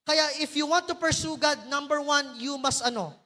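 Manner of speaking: usual speaking voice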